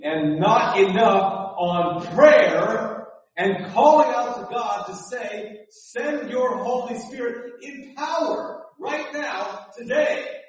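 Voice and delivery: loud, drawn-out, sung plea; preaching at full force. Acted. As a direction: call out to God, slow and high. Preacher calling out to God.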